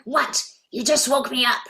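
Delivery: put-on high, croaky-old granny roused from sleep; old woman woken up. Mother in costume scolds trick-or-treaters, mock grumpy old woman. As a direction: play a grumpy old lady at the door. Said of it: "dress up like a much older woman".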